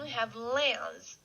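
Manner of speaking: slow, careful lens; model word lens. Shown as a demonstration. Teacher modelling lens for students to repeat. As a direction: say lens slowly so they can copy. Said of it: "Next one".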